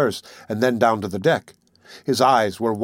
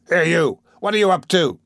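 higher-pitched challenge, called out; sharp cry; 5 semitones higher, 4 dB louder than usual. A narrator's reading. Captain catching a sailor climbing the rigging, crying out sharply. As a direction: cry out to stop someone. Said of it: "he cried".

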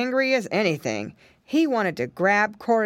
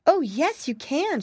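put-on higher-pitched little girl's glad reply; eager exclamation; 9 semitones higher than usual. A narrator's reading. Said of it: "she exclaimed".

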